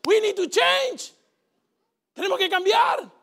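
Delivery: God's voice calling for change; voice of God urging. Acted. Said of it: "Dios lo utiliza para motivarte y decirte".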